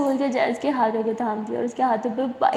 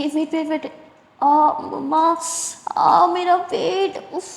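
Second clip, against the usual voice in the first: higher, slow pained moans; acted pain; 5 semitones higher than usual. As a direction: groan it out like a sudden cramp. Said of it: "बहुत दुख रहा है".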